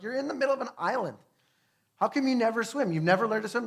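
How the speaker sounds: high, fast, disbelieving questions; can't believe they never swim; mock incredulity at the locals; incredulous.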